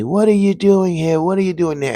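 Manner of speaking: hammed-up accusing voice pitched high; mock yelling. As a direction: dream people yelling at the intruder, high mock yell. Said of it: "they kept yelling at me".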